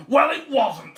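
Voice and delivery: high, loud contradiction; scolding wife. A narrator's reading. Wife contradicts farmer, loud and cross. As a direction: slap down his excuse. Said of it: "said his wife".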